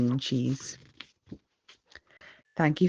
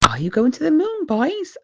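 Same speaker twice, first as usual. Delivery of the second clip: Daddy Pig asking; 11 semitones higher, 4 dB louder than usual. A narrator's reading. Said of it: "asks Daddy Pig".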